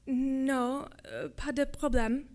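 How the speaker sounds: mildly startled, hesitant reply; slightly shaking voice. A narrator's reading. Startled girl answering soldier in French, slightly shaky. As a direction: hesitate a little, a touch unsettled. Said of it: "Her voice, shaking a little, said".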